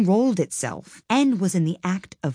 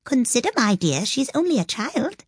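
high-pitched, meek voice; timid plea; 7 semitones higher than usual. A narrator's reading. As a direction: plead timidly with an overbearing wife. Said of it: "timidly said".

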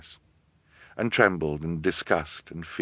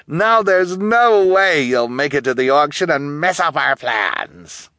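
high, theatrical villainess, stretching key words; gloating villainess; 8 semitones higher, 4 dB louder than usual. A narrator's reading. Guardian praising her accomplice to trapped orphans, gloating theatrically. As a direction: revel in the scheme, pitched up.

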